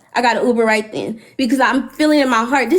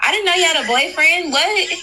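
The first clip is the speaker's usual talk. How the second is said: higher, incredulous rising voice; stunned disbelief; 4 semitones higher than usual. Real, not acted. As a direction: sound truly shocked at the news. Shocked disbelief at revelation.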